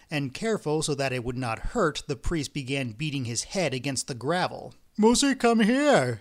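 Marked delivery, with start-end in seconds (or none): 5.0-6.2 high, loud alarmed call; frightened shout. A narrator's reading. Engineer calling his wife over, frightened shout. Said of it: "shouted the frightened engineer".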